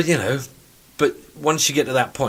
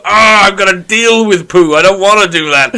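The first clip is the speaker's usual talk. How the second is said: mock disgust; 6 semitones higher, 10 dB louder than usual. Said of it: "You just go".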